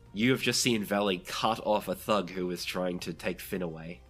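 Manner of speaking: ordinary talk, nothing marked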